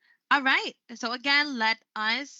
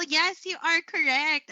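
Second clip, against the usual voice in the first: ordinary talk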